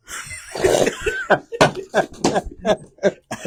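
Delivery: high breathy giggle burst; giggling fit. Real, not acted. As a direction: laugh you can't hold back. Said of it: "I can't keep a straight face".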